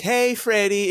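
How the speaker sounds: a sing-song friendly hello; imitating cheery wife. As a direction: make the wife sound blissfully naive. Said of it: "Sarah would be like".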